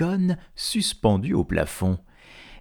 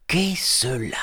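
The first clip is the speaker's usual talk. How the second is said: whisper; 3 semitones higher than usual. Acted. Isengrin wakes, finds roof open.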